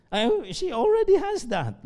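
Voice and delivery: high, slow, sure of himself; confident groom. Retold or imitated. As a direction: point it out like the obvious answer. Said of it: "groom answers pastor". (sagot ng lalaking ikakasal, mataas at mabagal)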